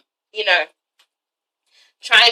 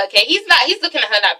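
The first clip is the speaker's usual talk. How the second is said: normal talking voice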